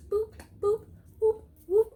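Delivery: high, short register beeps; high-pitched cashier scanner noises; imitated scanner boops. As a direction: boop like a checkout scanner.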